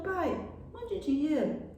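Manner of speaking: high, slow, gentle plea; softly imitated loving appeal; mother's tender reading. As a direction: stretch it into a sweet request. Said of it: "a entonação de voz".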